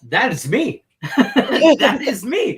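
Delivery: high, loud and laughing; gleeful retold exclamation. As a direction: crow it proudly through laughter. Retells delighted recognition of nickname.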